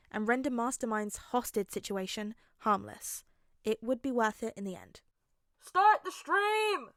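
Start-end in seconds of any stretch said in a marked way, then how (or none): 5.6-6.9 a much higher, slow, loud shout; called out. Acted. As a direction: bark the order from across the house. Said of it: "A voice called out".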